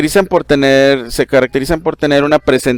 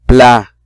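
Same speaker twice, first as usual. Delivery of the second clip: "pla" hit louder as the strong syllable; stressed syllable; 5 dB louder than usual. Shown as a demonstration. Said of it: "se escucha más fuerte".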